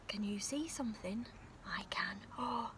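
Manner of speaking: usual speaking voice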